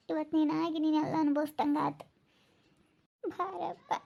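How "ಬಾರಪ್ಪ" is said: slow, soft and weeping; tearful plea. Real, not acted. Grandmother begs a relative to look at Viji, softly weeping. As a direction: beg him to look at her.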